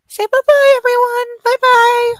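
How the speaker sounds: high sing-out bye-bye; puppy-talk goodbye. Pretend. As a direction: pitch it way up, as if for a puppy. Prompting puppy to wave goodbye.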